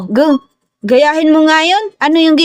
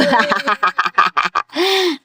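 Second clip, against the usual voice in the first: long, softer cackling laugh; amused laugh; 6 dB quieter than usual. Real, not acted. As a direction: let the laughter run out softly. Amused at the all-curve drawing. (mahaba at mahinang tawa)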